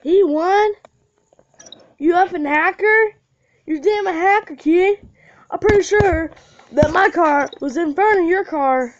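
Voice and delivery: high, loud furious accusation; outraged yell. Real, not acted. Gamer raging at the opponent who beat him, loud and furious. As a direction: yell it out at the cheater.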